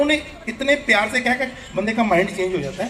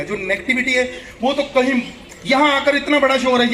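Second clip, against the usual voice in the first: usual speaking voice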